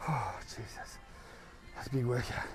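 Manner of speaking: soft breathless whisper; exhausted whisper. Real, not acted. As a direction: breathe it out spent. Exhausted after workout.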